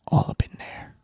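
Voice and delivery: breathy whispered aside; hushed, sly whisper. Pretend. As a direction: whisper it like a secret. Dogs sniffing each other.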